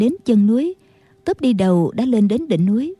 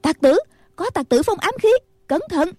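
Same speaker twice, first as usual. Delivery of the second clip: alarmed cry; 8 semitones higher than usual. A narrator's reading. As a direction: cry a warning to companions under attack. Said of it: "cũng la lên". (kêu cảnh báo hốt hoảng, cao giọng)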